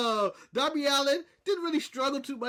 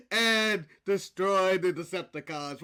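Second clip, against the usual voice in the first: lower, slow, booming robot leader; slow Optimus Prime; 5 semitones lower, slower than usual. Retold or imitated. Host mocking Optimus Prime's slow promo delivery. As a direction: drag every word out like Optimus. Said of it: "how slow he talks".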